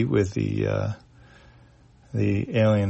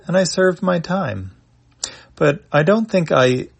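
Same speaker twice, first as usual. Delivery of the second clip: higher, earnest confessing voice; Chon's voice; 4 semitones higher, 5 dB louder than usual. Acted. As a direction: confess it with rising emotion. Chon's monologue over tea.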